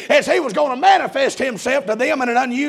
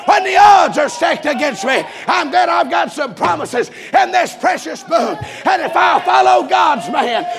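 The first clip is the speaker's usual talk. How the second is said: full-force preaching; 3 semitones higher than usual. Acted. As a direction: preach it hard and build to the peak.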